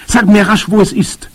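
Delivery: high, loud, pressing demand; urgent call. A narrator's reading. Boy demands an answer from Großmama, urgent call. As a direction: urge her to tell you at once. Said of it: "rief ich".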